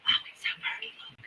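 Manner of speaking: breathy whispered awe over the pretty jars. Real, not acted. Admiring the jam colour to herself, whispering. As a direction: hush it like a secret.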